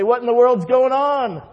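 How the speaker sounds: high, loud, acted outrage; exasperated boss. Returning owner confronts his right-hand person, exasperated outburst. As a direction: demand an explanation from slacking staff.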